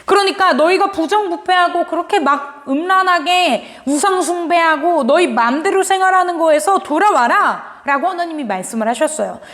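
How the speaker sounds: ordinary delivery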